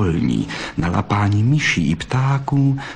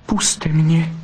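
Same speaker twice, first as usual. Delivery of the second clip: high, pleading little vixen voice; 7 semitones higher than usual. Acted. Vixen begs the fox suitor to let her go, high and pleading. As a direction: beg him gently to let go. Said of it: "zaprosila bystrouška".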